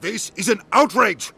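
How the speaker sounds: loud, high, indignant outburst; outraged protest. Acted. Ambassador protesting to the Council, outraged.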